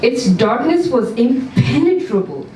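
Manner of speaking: plain reading voice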